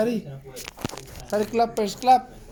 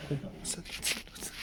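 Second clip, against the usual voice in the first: whisper; 16 dB quieter than usual. Real, not acted. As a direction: whisper it to build suspense. Teasing baby.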